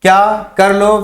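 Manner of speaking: ordinary delivery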